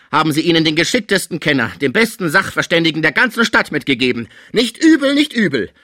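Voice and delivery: loud, high, rising indignation; indignant sarcasm. A narrator's reading. Sick man seeing through his greedy relatives, indignant loud sarcasm. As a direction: raise it to a sarcastic cry by the end. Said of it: "rief Pons unwillig".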